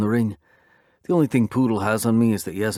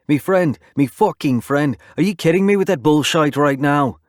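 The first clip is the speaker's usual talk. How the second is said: loud, high, thick-accented mock of the word friend; mocking angry shout; 6 semitones higher, 6 dB louder than usual. A narrator's reading. Biker mocking his friend's offer, loud angry accent. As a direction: throw his word back at him, furious. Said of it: "He's right".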